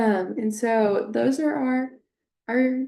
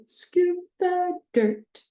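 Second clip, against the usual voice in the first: scoop stressed, higher and slow; acoustic highlighting; 9 semitones higher, 4 dB louder, much slower than usual. Shown as a demonstration. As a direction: sing it out high and slow for a child. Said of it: "then saying it with the visual".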